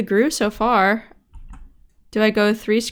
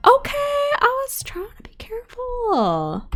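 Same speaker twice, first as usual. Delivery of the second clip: whispering; slower than usual. Real, not acted. Whispered retort after dying.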